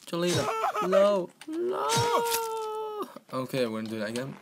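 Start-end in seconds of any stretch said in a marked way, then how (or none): 0.0-3.1 high hammed-up cry of no; overdone despair; mock anguish. Player crying out for dying Jelena, high hammed-up anguish.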